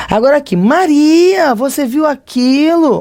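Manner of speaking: startled shout to a friend; astonished call. Shown as a demonstration. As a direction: call out to her in amazement. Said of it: "de maneira espantada".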